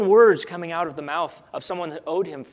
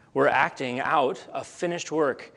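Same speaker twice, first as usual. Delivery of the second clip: normal talking voice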